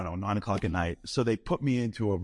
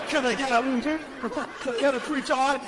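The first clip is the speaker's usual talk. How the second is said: preacher call-out; 11 semitones higher than usual. Retold or imitated. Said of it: "I'm like".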